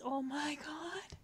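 normal talking voice

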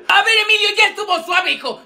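high-pitched exasperated reprimand; teacher's scolding. Acted. Fed-up teacher telling Emilio that is enough, exasperated scolding.

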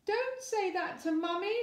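put-on a little girl objecting on Mummy's behalf; indignant girl. A narrator's reading. Daughter scolding her dad, indignant. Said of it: "said Laura indignantly".

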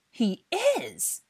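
very high, loud exclamation with IS hit hard; outraged insistence. A narrator's reading. Dennis insists the guest is a Death Eater, outraged. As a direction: burst out, insisting on IS.

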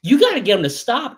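high, loud complaining teacher; teacher complaining to the parents, high and loud; exasperated teacher. Retold or imitated. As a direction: play the fed-up teacher calling home. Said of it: "What they were actually saying is".

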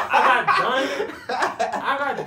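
laughing it out, slow and loud; a real burst of laughter. Real, not acted. Laughing while boasting about finishing.

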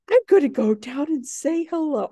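high, breathless gasp into an eager plan; eager dog voice. Retold or imitated. Teacher voicing her dog rushing to greet the deer, high and eager. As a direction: gasp and rush it like an overjoyed puppy. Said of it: "He was like".

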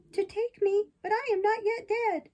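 high, loud protest; alarmed puppet. Acted. Puppet told the rabbits will take him, alarmed.

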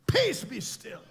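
Peace, be still called out high and loud; commanding rebuke. Acted. Jesus rebukes the storm, loud and commanding. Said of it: "he stood up and he said".

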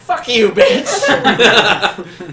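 high, loud, very drawn-out curse; vicious scream. Acted. Captured woman spitting at her captors, vicious scream. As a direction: scream it slowly, hammed-up venom. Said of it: "she is spitting at you and just vicious".

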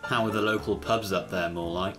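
quick cheeky jab; teasing Riley. Acted. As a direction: rib the boss.

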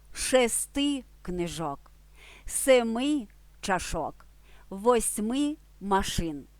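slow, with шести, семи, восьми each stressed; slow emphatic model. Shown as a demonstration. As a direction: spell out шести книжок, семи чашок, восьми машин slowly. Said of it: "Звісно". (повільно, з наголосом на шести, семи, восьми)

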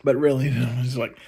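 normal talking voice

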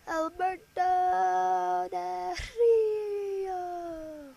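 big announcer shout of the name; ring announcer callout. Pretend. Calling out wrestler's name.

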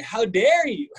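high, loud hammed-up indignation; mock outrage. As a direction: throw it at her like a soap star.

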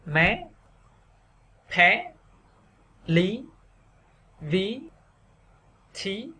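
"mé, thé, lý, ví, thí" each swept high; rising sắc tone. Shown as a demonstration. Teacher drilling stressed syllables for learners, rising tone. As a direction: lift each syllable, pausing between. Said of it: "đọc thêm dấu sắc".

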